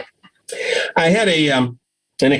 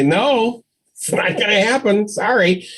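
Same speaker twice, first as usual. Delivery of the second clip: firm no; 6 semitones higher than usual. Shown as a demonstration. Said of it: "stand your ground and say".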